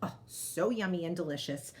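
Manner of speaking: usual speaking voice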